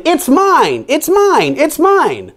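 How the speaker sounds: very high mocking chant of the frogs; mimicked squabble. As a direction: mimic their whiny bickering. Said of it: "you shouting".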